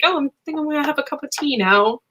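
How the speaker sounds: quick, breezy English gent imitation; casual Englishman. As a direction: toss it off like a relaxed Londoner. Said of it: "And he's just like".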